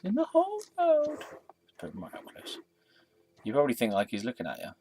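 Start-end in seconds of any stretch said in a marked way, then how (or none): 0.0-1.2 "In the whole world" repeated much higher; mock-grand repeat of "In the whole world", pitched way up; mock grand echo. Shown as a demonstration. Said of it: "in the, in the whole world". 1.8-2.6 whisper. Real, not acted. Whispered aside while setting up.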